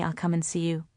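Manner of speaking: mother vowing to her daughter, voice dropping soft and low; hushed vow. Acted.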